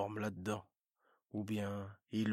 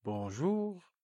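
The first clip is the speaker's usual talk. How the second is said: higher, bright merchant hello; chirpy salesman greeting; 8 semitones higher, 4 dB louder than usual. Acted.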